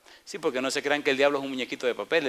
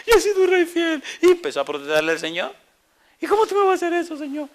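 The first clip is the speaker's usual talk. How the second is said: very high, loud hammed-up whining protest; complaining king; 5 dB louder than usual. Preacher mocking Ezekiel protesting to God, high and loud. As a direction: whine at God like a spoiled king.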